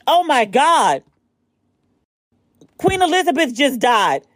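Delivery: high stunned what; shocked. Real, not acted. Reading the queen's death on her phone, shocked. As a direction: disbelief at the news.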